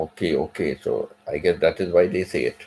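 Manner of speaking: normal talking voice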